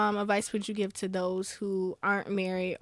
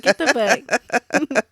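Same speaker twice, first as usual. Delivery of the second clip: laughing plug; 2 semitones higher, 8 dB louder, much slower than usual. Real, not acted.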